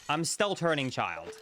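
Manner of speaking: usual speaking voice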